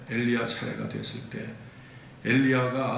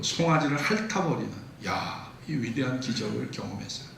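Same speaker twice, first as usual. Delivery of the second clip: ordinary delivery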